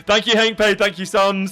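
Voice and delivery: high, loud and delighted; thrilled after a win; excited. Real, not acted.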